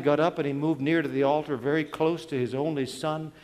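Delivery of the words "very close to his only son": usual speaking voice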